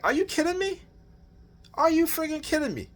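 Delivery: high, incredulous outburst; outraged disbelief. Retold or imitated. Husband retelling his reply to wife, high incredulous outburst.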